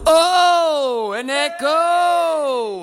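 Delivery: drawn-out shouted echo; canyon echo. Pretend. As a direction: stretch it out like a distant echo. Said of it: "why I sound like an echo".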